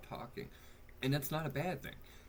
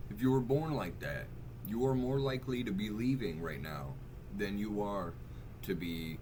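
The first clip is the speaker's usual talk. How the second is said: usual speaking voice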